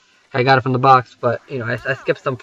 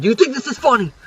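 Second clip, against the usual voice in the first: mock shout; 8 semitones higher, faster than usual. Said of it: "he's shouting out".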